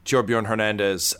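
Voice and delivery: ordinary delivery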